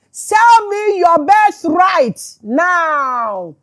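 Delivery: loud, drawn-out demand, pitched high; demanding Jacob. Acted. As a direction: stretch each word into a commanding demand. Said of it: "Jacob said".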